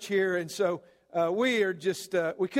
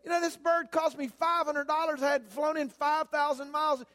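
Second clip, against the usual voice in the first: outraged son; 6 semitones higher than usual. Retold or imitated. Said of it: "He said".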